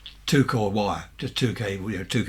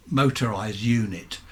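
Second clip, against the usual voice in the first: usual speaking voice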